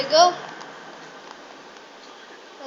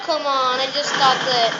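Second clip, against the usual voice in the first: ordinary talk, nothing marked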